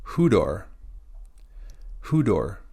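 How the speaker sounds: Hudor said with a clear breathy H at the start; model word. Shown as a demonstration. Teacher models the rough breathing in Hudor. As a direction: say Hudor with the H sound added. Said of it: "it's hudor".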